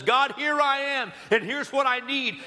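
high, bold call to God; preacher acting out faith speaking up; lifted voice. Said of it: "Faith rises up and lifts its voice and says".

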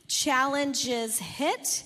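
ordinary delivery

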